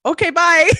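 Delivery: flustered goodbye. Retold or imitated. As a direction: starstruck and overwhelmed. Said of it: "all I could utter out was".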